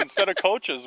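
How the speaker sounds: usual speaking voice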